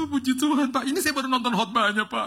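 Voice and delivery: overwhelmed driver gushing and half-sobbing; hammed-up crying driver. Driver weeping to the preacher he just recognized, mock-sobbing. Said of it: "Dia nangis tiba-tiba".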